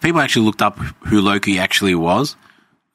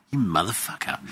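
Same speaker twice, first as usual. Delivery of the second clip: muttered curse; 2 semitones lower, 9 dB quieter than usual. Retold or imitated.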